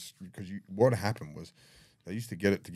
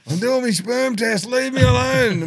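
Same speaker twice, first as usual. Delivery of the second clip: high, loud yelling back; defensive shout; 14 dB louder, slower than usual. Retold or imitated. Bob yelling at his cellmates to leave him alone. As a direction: shout them off, flustered.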